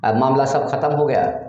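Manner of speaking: ordinary talk, nothing marked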